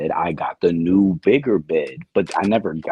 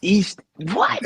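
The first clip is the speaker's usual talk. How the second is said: higher, incredulous exclamation; taken aback; 12 semitones higher than usual. Real, not acted. Contestant hearing he sounded East Indian, real startled disbelief.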